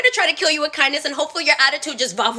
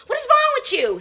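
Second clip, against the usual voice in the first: much higher, exasperated repeat; higher outraged; 9 semitones higher than usual. Retold or imitated. Repeating herself to the customer, higher and outraged. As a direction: push it up in disbelief.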